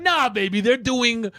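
high, slow playful voice; mock high reply. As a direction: answer her up high. His own reply in high voice.

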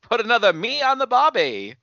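high-pitched, hammed-up Aussie accent; mock Australian accent. Co-host joins the joke about sounding Australian, mock Aussie accent.

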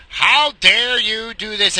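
husband mocks wife's gangster act, hammed-up. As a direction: imitate his wife acting gangster. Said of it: "puts on this act like she's a fucking gangster".

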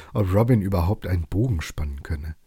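ordinary delivery, nothing marked

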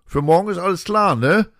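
loud, high shout into the room; calling in. Retold or imitated. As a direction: call it in casually from the door. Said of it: "rief der nur rein".

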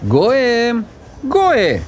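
put-on high, insistent calls for a child; calling out. Grandmother looking for her missing grandson, calling out. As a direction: call down the corridor, pitched high.

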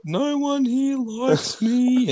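high, slow mock sobbing whine; mock bawling. Teller mimics his drunk crying friend, mock sobbing. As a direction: imitate a drunk crying mate. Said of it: "he was just bawling his eyes out".

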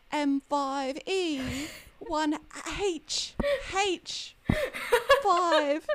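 hammed-up, high-pitched British accent, slow and spaced out; terrible British accent. Shown as a demonstration. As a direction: spell it out in a badly faked British accent. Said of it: "a really terrible British accent".

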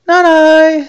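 very high, loud reindeer squeal; reindeer refusing. A narrator's reading. Reindeer refuses again in story.

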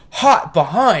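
imitated boss bellowing a kitchen warning; screaming. Boss showing a cook how to warn, screaming. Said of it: "he was, like, screaming".

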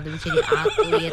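mock surprise. Teasing busy schedule.

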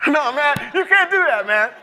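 high, laughing no; laughing refusal. Retold or imitated. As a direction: refuse him while cracking up. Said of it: "I said".

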